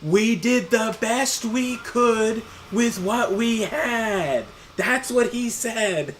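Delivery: slow, high, loud shout; drawn-out mock yell for someone who can't hear; retold yell. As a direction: re-enact yelling it word by word. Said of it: "and then I yell".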